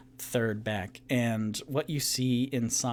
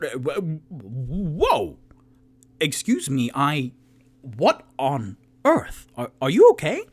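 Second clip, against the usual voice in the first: startled Toot Toot; 3 semitones higher, 7 dB louder than usual. Acted. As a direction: gasp at the wounded bartender's state. GM voices Toot Toot.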